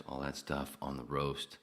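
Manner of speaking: ordinary talk, nothing marked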